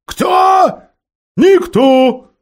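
high, loud owl shout. A narrator's reading. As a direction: yell it at a deaf ear. Owl shouting to deaf dwarf.

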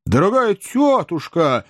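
high, drawn-out effusive greeting; effusive exclamation. A narrator's reading. Nephew greeting his aunt on arrival, effusive exclamation. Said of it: "воскликнул мистер Бен Эллен".

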